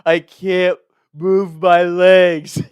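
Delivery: imitating a sobbing little brother; mock sobbing. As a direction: whine it like a crying kid faking nothing.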